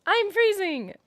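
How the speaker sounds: high whiny begging; whiny plea. Retold or imitated. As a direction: whine to be warmed up. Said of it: "so I'm like".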